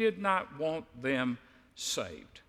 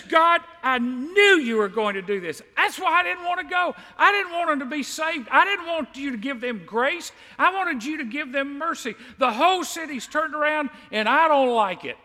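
higher and louder, a complaining outburst; angry Jonah; 9 semitones higher, 5 dB louder than usual. Retold or imitated. Jonah complaining to God about the saved city, angry rant. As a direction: rant at God like a sulking prophet. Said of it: "He's mad".

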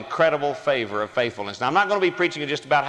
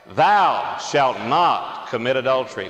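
congregation reading slowly together; slow, solemn group recitation with authority; many voices in unison; slower than usual. Real, not acted. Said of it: "So let's read aloud together".

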